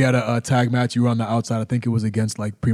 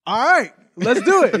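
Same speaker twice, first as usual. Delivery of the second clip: high, louder, fired-up go-time call; excited; 11 semitones higher, 4 dB louder than usual. Retold or imitated. Wrestler seeing his partner go wild, fired up. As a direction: pump yourself up to join the madness. Deciding to join the chaos.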